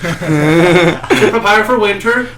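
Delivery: a hint of an old-world farmer pitching jams.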